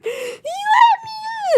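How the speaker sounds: very high, very slow drawn-out crying wail; mock wail. Imitating the friend wailing that he left, very high and slow. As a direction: stretch it into a sob.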